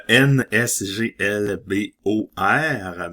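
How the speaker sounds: letters read out slowly, one by one; reading an eye chart. Shown as a demonstration. Reading scratch-card letters slowly like an eye chart. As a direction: spell them slowly like at the eye doctor. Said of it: "On dirait que je suis l'ophtalmo".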